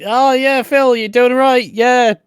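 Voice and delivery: breezily fob him off; mock brush-off. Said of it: "no one's like".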